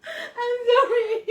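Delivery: high, drawn-out apology through laughter; helpless laughter. Real, not acted. Apologizing to co-host after a crude joke, helplessly laughing. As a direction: squeal it slowly, overcome with giggles.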